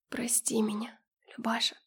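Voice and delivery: soft, whispered, affectionate plea; tender apology. A narrator's reading. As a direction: murmur it gently to someone close to tears. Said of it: "Слишком ласково, трогательно". (ласково, трогательно, почти шёпотом)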